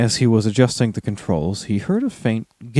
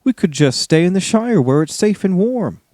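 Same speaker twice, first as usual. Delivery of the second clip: higher worried hobbit; Sam voice; 6 semitones higher than usual. A narrator's reading. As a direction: voice Sam a little higher and anxious. Sam voiced in read story.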